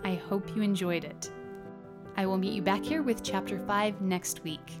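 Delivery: usual speaking voice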